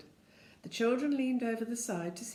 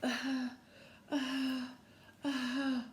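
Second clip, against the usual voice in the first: short puffed uh sounds like a tired engine; panting engine noise; 6 semitones higher, much slower than usual. Retold or imitated. Narrator imitating the aeroplane panting. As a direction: puff it out like a breathless machine. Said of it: "it went".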